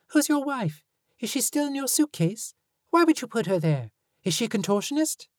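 put-on high, quick questioning kid; demanding child voice. A narrator's reading. Fee voiced, rapid questions.

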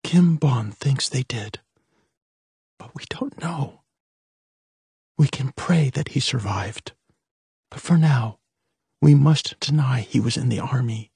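mother near tears telling her daughters; tearful. A narrator's reading. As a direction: close to tears, hushed. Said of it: "her eyes filling with tears".

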